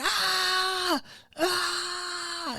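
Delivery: high, drawn-out imitated scream; high mock wailing cry. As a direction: wail it like a screaming patient. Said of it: "still screaming".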